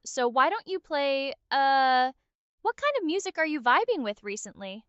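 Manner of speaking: normal talking voice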